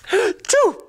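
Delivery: sharp gasp then a high, loud comic sneeze; hammed-up sneeze. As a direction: throw in a big silly sneeze. Mock sneeze mid ad pitch.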